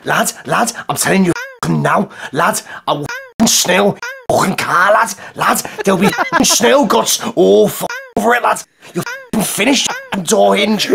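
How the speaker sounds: threatening Scouse lad, mostly near his own voice; Scouse hard man. Acted. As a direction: square up like a Liverpool lad, in a Scouse accent. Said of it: "do the impression of the man getting out of the car".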